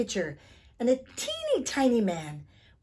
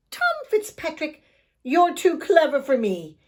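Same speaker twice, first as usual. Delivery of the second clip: leprechaun voice; 7 semitones higher, 8 dB louder than usual. Acted. Leprechaun gives in.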